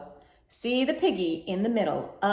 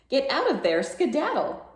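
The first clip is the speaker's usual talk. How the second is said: scolding mother; 6 semitones higher than usual. Acted.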